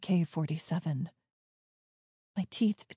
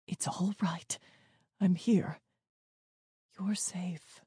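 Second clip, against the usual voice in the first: soft, gentle, hushed comfort; soothing reassurance; 6 dB quieter than usual. A narrator's reading. Dad with arms round his children, soothing reassurance. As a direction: reassure them softly, with pauses.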